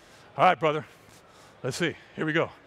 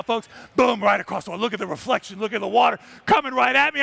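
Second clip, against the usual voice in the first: ordinary talk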